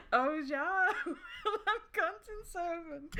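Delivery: high, slow words through laughter; real laughter. Real, not acted. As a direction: crack up while saying the name. Cracking up at own character name.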